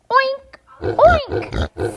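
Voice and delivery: high, loud snorty oink; pig oink. Acted. Reading to kids, a loud high piggy oink. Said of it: "says the pig".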